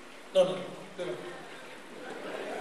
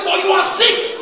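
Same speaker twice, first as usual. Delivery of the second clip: mock shout; 9 semitones higher, 9 dB louder, slower than usual. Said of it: "doctor say".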